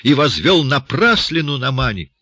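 high, indignant complaint; heated cursing. A narrator's reading. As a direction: rail at the man who fooled you. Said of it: "в сердцах выругался староста".

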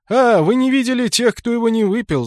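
high, loud laughing reply. Acted. Guard laughing at the knight's worry about medicine. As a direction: laugh it out at their doubt. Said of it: "засмеялся Жуль".

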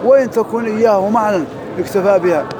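ordinary talk

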